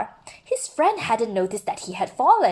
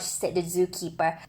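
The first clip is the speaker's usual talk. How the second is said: ordinary delivery, nothing marked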